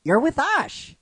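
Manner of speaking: high-pitched acted surprise; surprised realisation. She realises who Brock is with, surprised and high. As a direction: let the realisation lift the voice high. Acts her realising he's with Ash.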